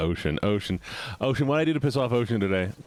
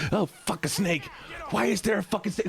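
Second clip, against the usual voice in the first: ordinary talk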